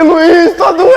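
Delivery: high, drawn-out wail to a friend; imitated pained cry. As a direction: whine it out as a mock sufferer. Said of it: "Ele começou a gritar assim". (gemido agudo de dor, imitado)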